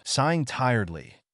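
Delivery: ordinary delivery, nothing marked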